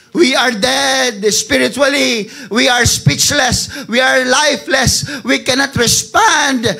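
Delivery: builds from firm to a loud, high, tearful cry; rising impassioned preaching. Acted. Pastor crying out to the congregation, building to a tearful shout.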